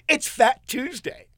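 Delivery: beaming, drawn-out reveal; gleeful announcement. Retold or imitated. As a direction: nurse delivers the punchline with a big grin. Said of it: "with a gigantic smile".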